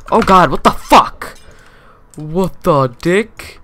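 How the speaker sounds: loud, high startled yelp, last words dragged slow; shocked outburst. Real, not acted. Gamer yelling at a baffling opponent, shocked outburst. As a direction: blurt it out in disbelief.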